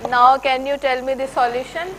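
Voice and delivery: ordinary talk, nothing marked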